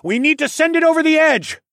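high, loud battle call; urgent shout. A narrator's reading. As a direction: shout the plan to your companion. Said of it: "I called over my shoulder".

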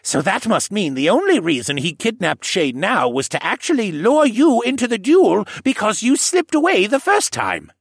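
put-on excited old koala working it out; old man voice. A narrator's reading. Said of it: "Jenner said".

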